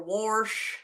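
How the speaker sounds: "wash" with an added r, like "warsh"; "wash" with an r before the sh; mimicked Southern pronunciation. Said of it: "I don't have an accent".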